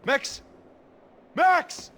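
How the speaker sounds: high, urgent calls for Max; calling out. Acted. Boss calling for his bodyguard Max during the attack, urgent call. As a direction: call him from across the room.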